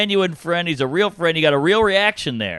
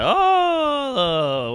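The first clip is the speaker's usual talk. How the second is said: fake ovation "oh"; 10 semitones higher than usual. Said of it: "You don't have to fake this".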